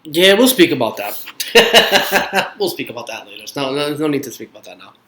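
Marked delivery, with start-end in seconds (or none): 1.3-2.5 breathy burst of laughter; real, spontaneous laugh. Real, not acted. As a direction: laugh it off before answering. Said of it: "from all this laughing".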